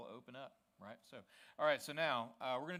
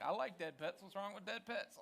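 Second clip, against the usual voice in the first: higher-pitched mock protest; imitated objector; 8 semitones higher, 4 dB louder than usual. Pastor imitates a girl defending dead pets, mock protest. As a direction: put on an indignant higher voice, quoting her. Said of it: "she's like".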